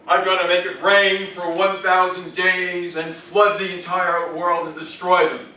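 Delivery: loud, slow divine proclamation; booming God voice. Acted. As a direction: declare doom like an almighty god. God threatens flood, loud.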